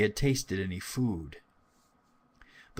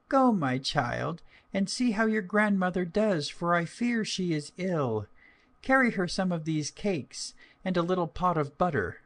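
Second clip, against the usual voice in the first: mother's voice; 8 semitones higher than usual. Acted. Mother to daughter, higher gentle voice. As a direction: voice the mother sending her daughter off.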